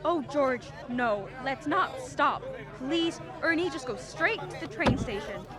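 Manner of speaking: loud urgent pleading. Acted. Mary begging George to skip the bank run, loud and pleading. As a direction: beg him not to stop, rising panic.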